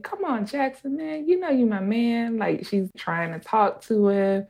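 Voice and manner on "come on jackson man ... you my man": imitating Ree coaxing. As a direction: coax the boy sweetly like the barber. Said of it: "And Ree is like".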